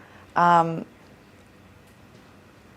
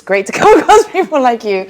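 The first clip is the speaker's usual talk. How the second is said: laughing; 6 semitones higher, 8 dB louder than usual. Real, not acted. Laughing while complimenting guest.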